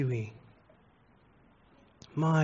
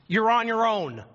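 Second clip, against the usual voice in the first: higher, loud bitter verdict; bitter final line; 8 semitones higher, 4 dB louder than usual. Retold or imitated. Richard Pryor telling the stunned audience, high and loud. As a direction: throw it at the crowd then leave. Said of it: "he looks at everybody and he says".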